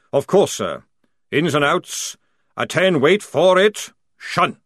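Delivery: loud parade-ground call; drill command. Acted. Sergeant calls the squad to attention, loud.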